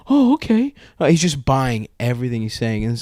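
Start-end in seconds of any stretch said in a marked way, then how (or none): 0.1-0.7 high-pitched naive agreement; gullible kid. Retold or imitated. Kid buying the teammate's lie, naively. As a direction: imitate a kid who believes everything.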